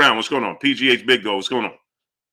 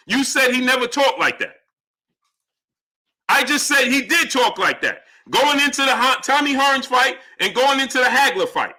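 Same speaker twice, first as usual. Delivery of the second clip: high, heated argumentative voice; exasperated insistence; 5 semitones higher than usual. Real, not acted. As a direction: hammer the point at a stubborn viewer. Arguing back at a viewer.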